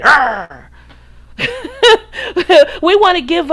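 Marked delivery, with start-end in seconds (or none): 0.0-0.7 one more hammed-up Arr of frustration; frustrated growl. Mocking women who give up, a playful growl. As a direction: growl it like a fed-up pirate.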